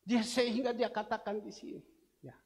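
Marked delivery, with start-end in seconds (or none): none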